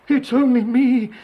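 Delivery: high, loud goat voice; middle goat voice. A narrator's reading. Second goat answers the troll, high loud voice. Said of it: "said the second billy goat Gruff".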